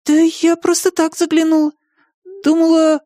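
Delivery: put-on high, girlish mumble; flustered girl. A narrator's reading. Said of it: "машинально пробормотала".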